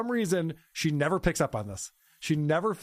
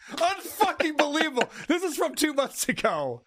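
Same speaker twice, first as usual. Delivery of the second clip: pitched way up in disbelief; can't believe it's happening again; high, incredulous exclamation; exasperated disbelief; 11 semitones higher than usual. Real, not acted. Host reacts to repeat offense.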